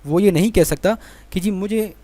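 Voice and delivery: ordinary talk, nothing marked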